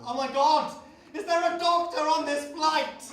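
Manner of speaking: high, loud, drawn-out shout; hammed-up film panic; melodramatic emergency call. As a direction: call out to the whole cabin in mock panic. Film character calls out in emergency.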